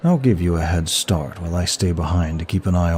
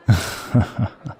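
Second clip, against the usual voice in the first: short rippling laugh; amused chuckle; 4 semitones higher than usual. Acted. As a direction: chuckle with amusement after a kiss. Amused laugh after a kiss.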